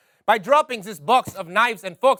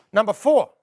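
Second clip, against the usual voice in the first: ordinary talk, nothing marked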